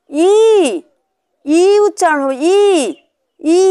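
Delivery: ই held long as a clear "ee"; vowel sound demo. Shown as a demonstration. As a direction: draw out ই for learners to copy. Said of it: "হস্যিকারে কি শব্দ হবে".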